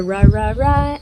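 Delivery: "ra, ra, ra" said with an English R, not the French throaty one; wrong R. Shown as a demonstration. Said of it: "Otherwise, you're going to do the".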